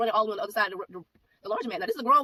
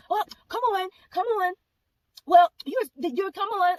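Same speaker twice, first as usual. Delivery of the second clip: much higher and louder coaxing imitation; mock pushy lady; 10 semitones higher, 7 dB louder, slower than usual. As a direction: mimic the stranger insisting, pitched way up. Strange lady pushing past mother.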